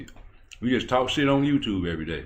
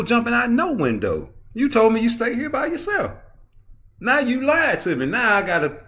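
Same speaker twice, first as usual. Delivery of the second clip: excited high rant; 12 semitones higher, 3 dB louder than usual. Real, not acted. Animated story about lying woman.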